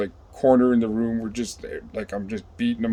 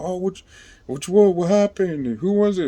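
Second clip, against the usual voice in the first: high-pitched imitation of curious inmates; eager questioners; 9 semitones higher than usual. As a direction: mimic people pressing for the story, pitched up. Curious inmates voiced asking what happened.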